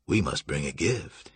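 low, softened pronouncement; solemn Julian. A narrator's reading. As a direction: state it low and measured. Julian solemnly gives his decision.

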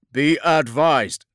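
loud, high, slow radio call; sergeant over vox. Acted. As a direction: report in over a battlefield radio. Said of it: "came Sergeant Marcus's voice over the vox".

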